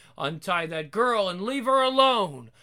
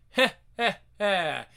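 evil chuckle; 3 dB louder than usual. Acted. Horror host cackle.

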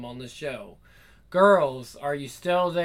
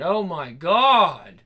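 ecstatic cry; 8 dB louder, much slower than usual. Acted. Legs shaking, crying out.

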